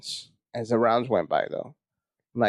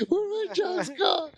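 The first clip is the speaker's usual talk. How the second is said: higher and slower, a near-sobbing whine; mock crying panic; slower than usual. Comic panicking to himself before stage, slow sobbing whine. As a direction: drag it out like you are about to cry.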